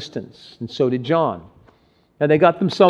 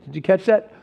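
ordinary delivery

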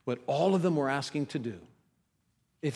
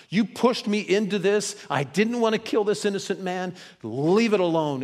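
raised, louder, fed-up protest; exasperated refusal; 5 semitones higher, 4 dB louder than usual. Acted. Pilate dismissing the priests who pushed him, exasperated. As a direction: push back and shut the argument down.

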